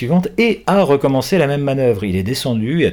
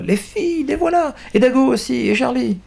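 much higher, joyful cry. Acted. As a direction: shout with delight at seeing them. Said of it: "Mick pousse un cri de joie".

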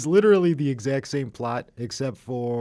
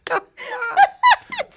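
shrieking laugh; 10 dB louder than usual. Real, not acted. Cracking up at the story.

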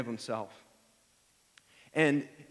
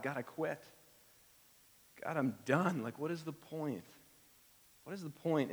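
soft and defeated, much quieter; weary giving up; 8 dB quieter than usual. Acted. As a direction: let it sink, exhausted. Voicing despair aloud to God.

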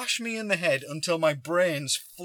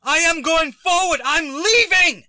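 slow, very loud high bellow; re-enacted angry shout; 14 semitones higher, 10 dB louder, slower than usual. Driver refusing to back up, re-enacted furious bellow. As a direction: bellow each word in defiance. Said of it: "And then I get angry".

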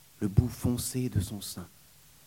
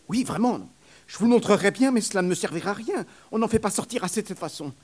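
fast, high, loud nurse voice; eager nurse; 10 semitones higher, 6 dB louder, faster than usual. Acted. Nurse insisting to the young man, fast and high. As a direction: rush it out eagerly.

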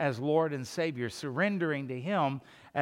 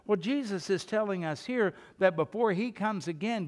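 normal talking voice

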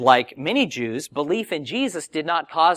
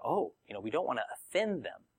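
much softer, acted reluctant aside; timid excuse; 11 dB quieter than usual.

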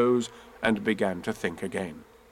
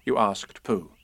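ordinary delivery

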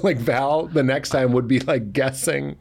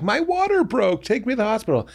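mock movie labor panic; 11 semitones higher than usual. Sitcom cliché quote.

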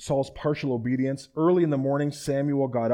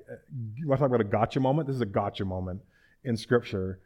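ordinary talk

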